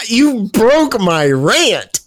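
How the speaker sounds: high, drawn-out playful protest; mock outrage. Host blaming the co-host for derailing his rant, mock outrage. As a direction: wail it in mock complaint, on the edge of laughing. Mock complaint after cracking up.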